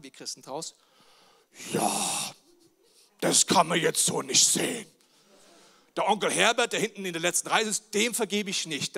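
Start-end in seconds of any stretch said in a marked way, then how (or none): 1.7-4.9 mock pious excuse. Christen mimicked excusing themselves. 5.9-9.0 loud, mock-indignant grudge; mock stubborn refusal.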